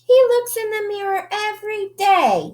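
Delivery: high, teasing sing-song of the sisters. Acted. Sisters tell mama about their brother, teasing and high.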